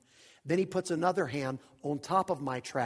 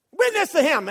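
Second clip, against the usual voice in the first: retold divine command; 12 semitones higher, 11 dB louder than usual. God overrules the preacher's excuses, loud insistent command. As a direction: command repeated high and loud.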